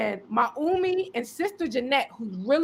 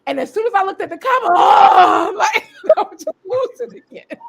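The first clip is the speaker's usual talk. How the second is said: tearful outburst; 5 semitones higher, 6 dB louder than usual. Real, not acted. Overwhelmed retelling at the Kaaba.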